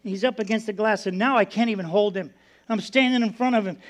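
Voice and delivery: ordinary delivery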